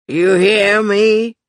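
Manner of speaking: put-on slow, loud drunken demand. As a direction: slur it at her, pushy. Drunk uncle demanding an answer.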